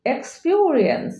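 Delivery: "এক্সপিরিয়েন্স" slowly as eks-pio-ri-ens; correct pronunciation. Shown as a demonstration. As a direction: stretch the middle vowel of এক্সপিরিয়েন্স into a glide. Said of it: "correct pronunciation হবে". ("এক্সপিরিয়েন্স" ধীরে শুদ্ধ উচ্চারণ)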